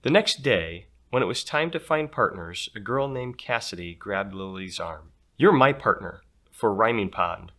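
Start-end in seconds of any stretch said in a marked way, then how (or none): 5.4-7.5 put-on a loud bossy girl's announcement; bossy classmate announcing, louder. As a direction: claim her partner, lightly voiced. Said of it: "Cassidy announced".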